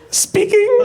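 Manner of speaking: very high put-on squeak; hoarder woman. Hoarder answering the producer's call, very high put-on voice. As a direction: answer in a tiny, high character voice. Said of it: "And she's like".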